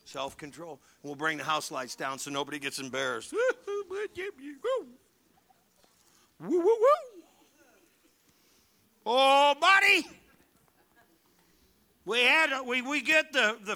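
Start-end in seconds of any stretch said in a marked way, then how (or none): 3.4-4.9 high laugh. Real, not acted. Joking about self-control. 6.4-10.1 high, loud whoops, slowing into oh buddy; excited whoop. Real, not acted. Joking about losing self-control.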